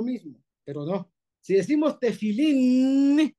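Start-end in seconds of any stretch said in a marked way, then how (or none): 2.0-3.3 TEFILÍN with a long held, louder n; final nun. Shown as a demonstration. Teacher demonstrating nun sofit, prolonged n. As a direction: hum on the last n of "tefilín". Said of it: "la nun es n".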